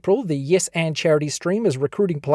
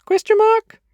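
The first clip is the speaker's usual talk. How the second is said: squeaky question; 4 dB louder than usual. Acted. Jokey spoken punctuation.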